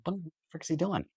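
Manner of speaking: hushed, puzzled thinking aloud; puzzled muttering. Retold or imitated. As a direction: wonder quietly to yourself. Puzzled watching his son, retold.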